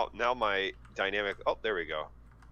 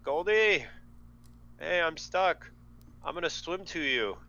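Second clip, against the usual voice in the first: raised, higher-pitched call to a friend; 7 semitones higher than usual. Acted. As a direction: call over the water. Character calls to distant companion.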